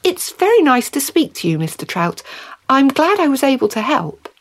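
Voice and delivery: eager-to-please woman meeting a talking fish; lifted, courteous delight; polite Mary. Acted.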